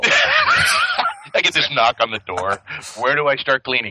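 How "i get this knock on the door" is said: laughing. Real, not acted. Joking about owing someone now.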